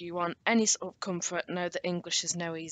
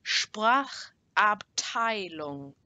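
very slow, broken into separate parts; slower; much slower than usual. Shown as a demonstration. Teacher models the S P word for learners, slower. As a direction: stretch each part out for learners. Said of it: "I'll say it a bit slower".

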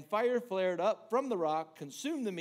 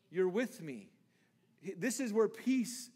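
ordinary talk, nothing marked

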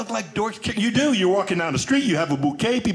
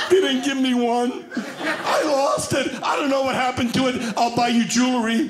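higher, laughing through lame excuses; 6 semitones higher than usual. Retold or imitated. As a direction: giggle out flustered excuses. Caught lying to wife.

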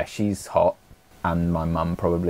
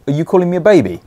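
mock offended; 8 semitones higher, 7 dB louder, faster than usual.

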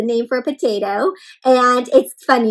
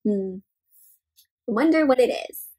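ordinary delivery

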